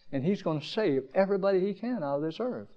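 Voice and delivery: usual speaking voice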